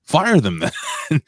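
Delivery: high exasperated outburst. Real, not acted. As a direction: throw up your hands, disbelieving. Exasperated at lax agents.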